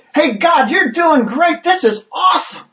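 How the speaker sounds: excited onlooker cheer. Pretend. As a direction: cheer God on like a thrilled spectator. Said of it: "you might step in and go".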